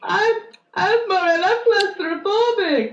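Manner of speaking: put-on high whiny baby voice; whiny man child. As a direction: whine it like a big baby. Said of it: "Whining like a little baby".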